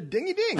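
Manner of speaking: high, laughing ding of a bell; playful bell imitation. Cohost rings the bell on a point, playful high ding. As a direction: ring an imaginary bell with your voice. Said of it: "That was ringing the bell".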